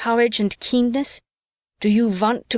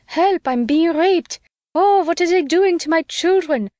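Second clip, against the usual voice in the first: higher, hammed-up cry for help; mock shrieking woman; 8 semitones higher, 3 dB louder than usual. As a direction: parody a helpless woman screaming. Mocking women's cries of distress.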